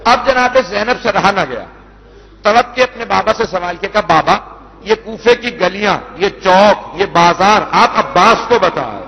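measured, mournful majlis recitation; masaib recitation. Acted. Reciter telling the Kufa story to mourners, mournful. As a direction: chant the tragedy to the mourners.

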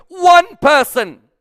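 high, loud, slow shout of "One person"; climactic shout. Acted. Preacher shouting the smallest number to the congregation. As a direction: hit it as the peak of the countdown. Sermon climax, counting down listeners.

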